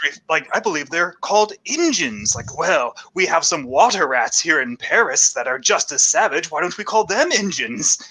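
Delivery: affected, swooping mock-gentleman voice; foppish gentleman. Fop at the front of the crowd, affectedly appalled. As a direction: play a scandalized fop, drawing out the exclamations. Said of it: "like, foppish gentlemen".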